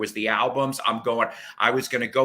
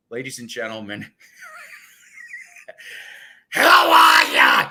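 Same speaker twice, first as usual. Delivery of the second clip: hammed-up talk-show host welcome; Dick Cavett impression; 4 semitones lower, 9 dB louder than usual. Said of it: "He goes".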